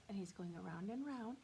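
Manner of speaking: ordinary delivery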